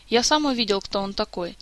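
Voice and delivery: plain reading voice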